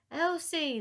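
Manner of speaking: high, loud shout of a name. Acted. Gang shouting to Elsie from the bridge, loud shout. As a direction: yell her name across the ice. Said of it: "they shouted on seeing her".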